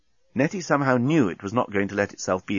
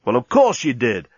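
mildly irritated retort; short-tempered; 4 dB louder than usual. A narrator's reading. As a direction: answer with a touch of impatience. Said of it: "Dan was getting a bit short-tempered".

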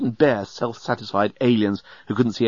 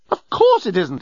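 indignant outburst, pitched up; exploding; 7 semitones higher, 3 dB louder than usual. A narrator's reading. Journalist contradicting Nettie about traffic jams, exploding. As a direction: burst out in exasperation. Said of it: "exploded the journalist".

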